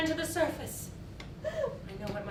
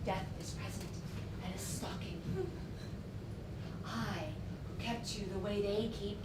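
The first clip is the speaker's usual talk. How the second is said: much softer, low menacing murmur; ominous hush; 5 semitones lower, 11 dB quieter than usual. Acted. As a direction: let the threat sink in quietly. Ominous threat of death.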